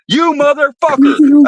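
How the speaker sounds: imitating a pissed-off guy's yell; angry stranger cursing the prankster; mock angry yell. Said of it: "he's yelling at me".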